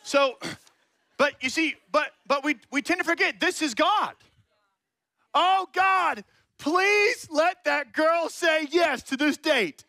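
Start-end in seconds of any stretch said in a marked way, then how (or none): 5.3-9.8 high, loud pleading to God, slow; desperate prayer. Pretend. Mocking a young man begging God for a date, loud and high.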